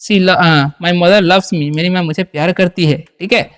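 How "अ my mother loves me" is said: usual speaking voice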